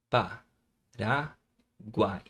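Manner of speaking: "Pa, ra, guai" with short pauses between syllables. Shown as a demonstration. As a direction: repeat it in pieces: "Pa", "ra", "guai". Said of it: "Vamos separar".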